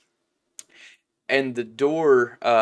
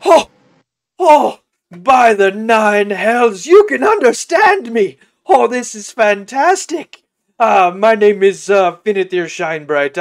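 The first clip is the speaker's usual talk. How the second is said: high, loud, effusive sheep voice; theatrical, thrilled animal character, pitched up; overjoyed talking sheep; 9 semitones higher, 13 dB louder than usual. Acted. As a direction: gush in amazement at being understood.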